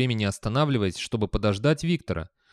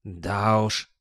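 grumbling sigh. A narrator's reading. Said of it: "пробурчал Гаспод со вздохом".

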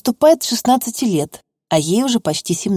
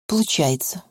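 softer, dismissive retort; sulky snort; 2 semitones lower, 7 dB quieter than usual. A narrator's reading. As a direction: toss the word off with a sniff. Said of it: "фыркнула девочка".

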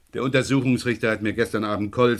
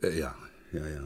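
whispered, embarrassed little yeses; half-whispered under his breath; muttered whisper; 7 semitones lower, 9 dB quieter than usual. Acted. Inspector brushed off by the school secretary, whispering.

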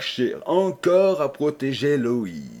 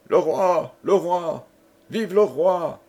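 high, ringing acclaim; raised-pitch jubilant shouts; crowd cries; 7 semitones higher than usual. A narrator's reading. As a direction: call out like a distant cheering crowd. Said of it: "Des cris éclataient".

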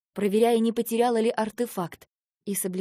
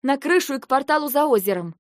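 higher, louder urgent order; urgent command; 5 semitones higher, 6 dB louder than usual. Acted. Heroine urging the guard toward the portal, urgent. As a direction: keep driving him on.

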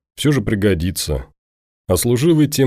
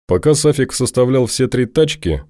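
ordinary delivery

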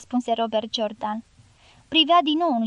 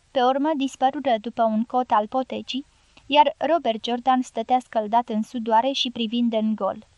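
ordinary delivery

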